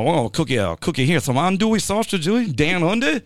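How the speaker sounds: Australian slash South African, climbing high; hammed-up Australian cook, rising to a high finish; bad Australian accent. Host spoofs a cooking video from down under, bad Australian accent. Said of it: "weird Australian slash South African".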